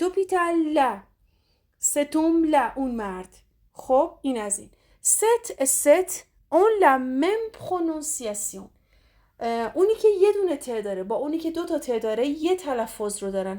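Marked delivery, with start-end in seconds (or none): 5.0-8.7 slow emphatic teaching. Shown as a demonstration. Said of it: "teacher stressing the rule".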